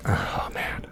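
breathy, almost silent; whispered sigh. Retold or imitated. Wrapping up the road-rage story, whispered. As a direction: let it fall out as a tired whisper.

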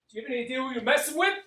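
high-pitched threat building to a loud finish; over-excited movie quote. Acted. Player doing a movie quote for the game host, over-excited. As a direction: deliver the threat with giddy, overeager force. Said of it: "I was too excited to do it".

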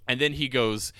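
usual speaking voice

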